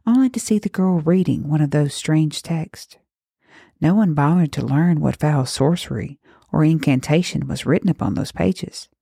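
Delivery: ordinary delivery, nothing marked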